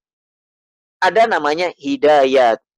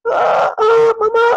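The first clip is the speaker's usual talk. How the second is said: high, loud mock baby wail; imitated baby cry; 5 dB louder, much slower than usual. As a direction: wail "mama" like a crying baby, badly faked. (tiruan tangisan bayi)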